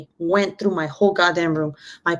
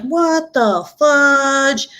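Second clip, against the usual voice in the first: very slow, stretched-out higher exclamation; exaggerated disbelief; 6 semitones higher, much slower than usual. Mother reacting to wrecked room, drawn-out mock disbelief. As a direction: drag every word out in mock shock.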